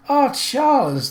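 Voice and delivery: high, slow hearty hail; the prince hails Charles with gusto; delighted greeting. A narrator's reading. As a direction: greet an old friend with delight. Prince greets old friend warmly.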